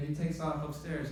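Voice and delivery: ordinary talk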